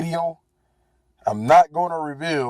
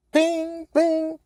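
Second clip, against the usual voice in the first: high sung ping, ping like a notification chime; phone ping; 13 semitones higher than usual. Retold or imitated. Driver imitating the ride app's ping, high chime. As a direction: mimic the app alert, pitched way up.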